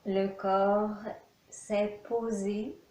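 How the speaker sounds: ordinary talk, nothing marked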